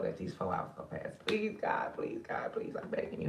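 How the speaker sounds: desperate whispered plea to God; whispered prayer. Real, not acted. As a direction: beg God under your breath, barely voiced. Tearful prayer for sick dog.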